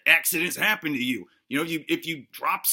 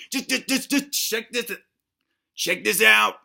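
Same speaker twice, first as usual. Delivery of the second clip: high, pushy check this out; excited insisting; 6 semitones higher, 5 dB louder than usual. Acted. Host urging doubters to look, rising excitedly. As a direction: get worked up and point at yourself.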